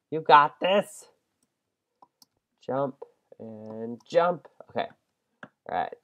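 ordinary talk, nothing marked

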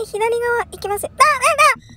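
normal talking voice

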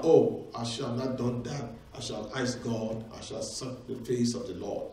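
low, soft acted remorse; quiet regret. Acts out a regretful sinner's realisation.

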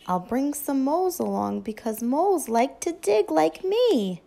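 ordinary delivery, nothing marked

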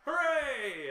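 high, loud tavern cheer; crowd cheer. Acted. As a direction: throw it up like a whole room cheering. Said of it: "you hear people going".

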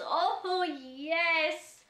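ordinary talk

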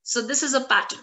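ordinary delivery

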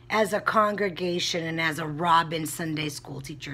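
usual speaking voice

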